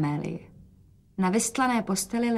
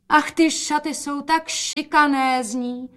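higher, slow gushing exclamation; exclaiming; 6 semitones higher, slower than usual. A narrator's reading. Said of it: "zvolala matka". (nadšené zvolání)

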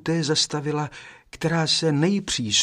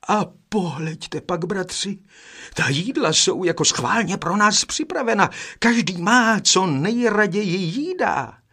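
much higher, excited calling out; excited exclamation; 8 semitones higher, 3 dB louder than usual. A narrator's reading. Second brother showing the brothers the prepared food, exclaiming. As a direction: call the others over to look, voice raised high. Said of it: "zvolal druhý".

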